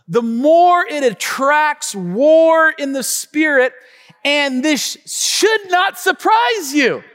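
high, loud, slow preaching. Acted. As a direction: drive it home to the church. Preaching at full force.

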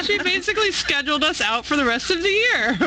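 high, laughing delivery. Real, not acted. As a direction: talk through real laughter. Laughing about the co-host's list.

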